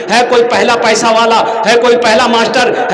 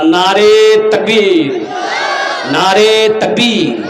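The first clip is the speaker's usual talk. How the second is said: slow rallying slogan call; much slower than usual. Real, not acted. Calls crowd for slogan.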